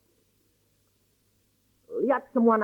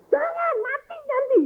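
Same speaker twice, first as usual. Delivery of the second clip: much higher, put-on old man's voice; old grandfather forbidding. Grandfather again refuses the grandmother, high and stubborn.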